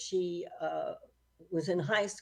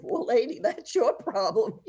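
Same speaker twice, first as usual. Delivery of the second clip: hammed-up "not my problem" attitude; retold brush-off; 5 semitones higher, 9 dB louder than usual. Gas station clerk tells a stranded customer it's her problem, mock dismissive.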